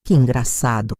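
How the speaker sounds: low, calm, deliberately unamused delivery; unamused irony. A narrator's reading. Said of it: "Do jeito que se diz quando algo não é engraçado".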